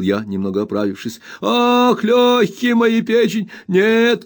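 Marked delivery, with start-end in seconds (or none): 1.4-4.2 put-on high, slow, whining old miser's wail; much higher, drawn-out spiteful whimper; spiteful whining old man. A narrator's reading. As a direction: whine it out spitefully like a crazed old junk dealer. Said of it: "крикнул старик".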